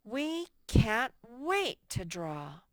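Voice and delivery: a teacher reading the corrected sentence with real excitement; excited exclamation. Shown as a demonstration. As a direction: read it as if you truly can't wait. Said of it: "let's do that for them".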